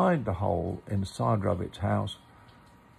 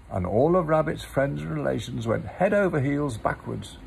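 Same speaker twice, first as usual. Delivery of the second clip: story narration; 5 semitones higher, 4 dB louder than usual. A narrator's reading. Reads a Pooh story aloud.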